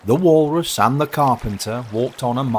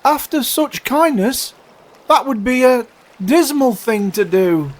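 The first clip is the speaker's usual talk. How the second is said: high, loud, pleading little voices; crying oysters; 7 dB louder than usual. Acted. Oysters pleading with walrus not to eat them, alarmed. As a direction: protest in a high wail. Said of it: "the oysters cried".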